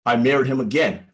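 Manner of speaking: ordinary talk, nothing marked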